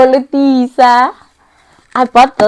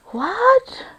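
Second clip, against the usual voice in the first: soft, high, tearful amazement; about to cry from joy; near tears; 9 dB quieter than usual. Real, not acted. To viewers over her new laptop, tearful and soft. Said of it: "I'm gonna cry".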